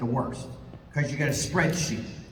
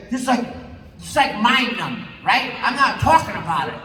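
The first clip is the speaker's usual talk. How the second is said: ordinary talk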